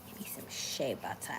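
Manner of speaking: soft unvoiced whisper off to the side; whispered aside. Real, not acted. Whispering to someone off-camera for shea butter. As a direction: whisper a quick request to someone in the room.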